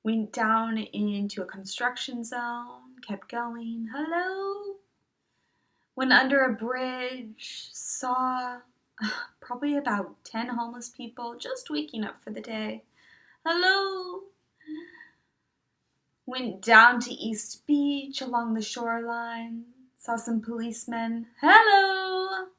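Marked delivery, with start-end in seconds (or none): 3.9-4.8 high, drawn-out hello; high singsong call; singsong greeting. Retold or imitated. Calling greetings along her run. 13.4-14.2 high, drawn-out hello; high singsong call; singsong greeting. Retold or imitated. Calling greetings along her run. 21.4-22.5 high, loud singsong hello; ringing, drawn-out hail; called-out greeting. Retold or imitated. Calling greetings along her run.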